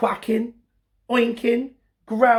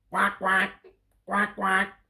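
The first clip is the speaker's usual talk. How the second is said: duck quack; slower than usual. Acted. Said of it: "came the reply".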